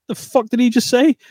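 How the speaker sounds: high-pitched incredulous reaction; shocked disbelief. Retold or imitated. Acting out own shocked reaction.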